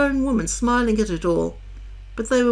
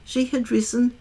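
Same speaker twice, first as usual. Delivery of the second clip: usual speaking voice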